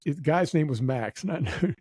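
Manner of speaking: normal talking voice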